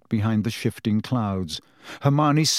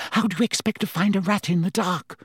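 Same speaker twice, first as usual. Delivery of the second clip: higher, sharp retort; snapping; 7 semitones higher, faster than usual. A narrator's reading. Hermione snapping at Harry over the rat. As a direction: snap back at him in exasperation. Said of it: "snapped Hermione".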